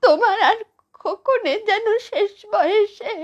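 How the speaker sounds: high-pitched wail building to sobs; crying plea. Acted. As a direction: break down as you plead. Grandmother breaks down crying.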